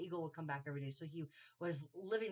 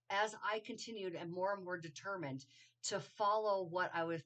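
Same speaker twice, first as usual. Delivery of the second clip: normal talking voice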